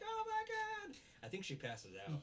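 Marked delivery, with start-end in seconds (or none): 0.0-1.0 very high, louder shriek; mock scream of fright. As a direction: squeal it as she sees the scary face. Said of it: "She's like".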